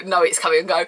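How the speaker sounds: normal talking voice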